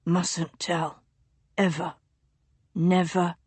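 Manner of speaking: low, soft secretive murmur; delirious duke whispering a childhood secret; feverish duke. A narrator's reading. Feverish duke's voice.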